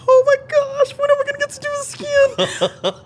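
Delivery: very high, loud, gushing and breathless; giddy high squeal with a laugh in it. Retold or imitated. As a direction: squeal it like a kid let loose in a candy store. Gushing over driving the car.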